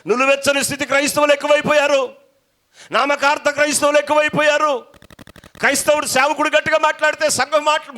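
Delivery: high, loud pulpit shouting; fiery preaching. Acted. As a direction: preach it with full force. Preaching at full force.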